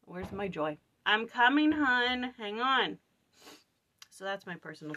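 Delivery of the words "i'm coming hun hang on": higher, louder call to her little one. Real, not acted. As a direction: shout across the house, reassuring. Calls out to her child.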